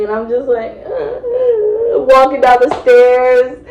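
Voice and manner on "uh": high-pitched fake wail; put-on drunken sob; mock crying. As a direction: whimper it out high, hammed-up. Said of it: "but I'm crying".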